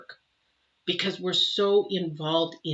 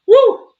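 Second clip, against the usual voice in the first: 1 emotional whoop; 17 dB louder than usual. Real, not acted. Overwhelmed, loud cry out.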